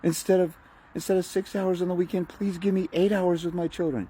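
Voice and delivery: high, whimpering beggar before the judge; mock groveling plea. Mocking a father begging the judge, pleading pathetically. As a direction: grovel and plead like a cowering servant. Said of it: "you crawl in there".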